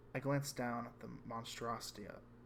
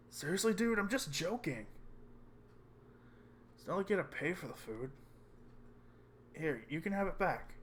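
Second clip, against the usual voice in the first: high placating voice; 6 semitones higher, 3 dB louder than usual. Acted. Friend apologizes for the joke.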